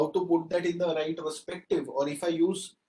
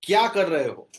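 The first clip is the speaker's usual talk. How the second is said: loud question; 6 dB louder than usual. Shown as a demonstration. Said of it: "difference in the three things".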